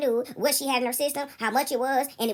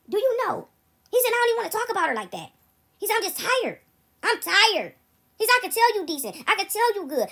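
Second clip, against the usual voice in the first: higher voiced imitation of a worn-out young man; tired son; 7 semitones higher than usual. Son telling her he is tired, higher and weary. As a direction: let his weariness rise in pitch.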